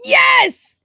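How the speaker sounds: high, explosive excited shout; very loud delight. Real, not acted. Fan hearing co-host just picked up the game, shouting happily. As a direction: burst out with a real fan's joy. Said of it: "Sorry, that was so loud".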